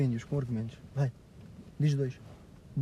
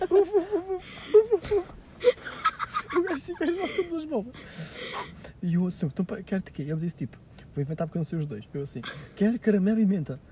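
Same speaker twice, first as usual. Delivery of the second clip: laughing through story; 7 semitones higher than usual. Retold or imitated. Retelling ice-cream shop mix-up.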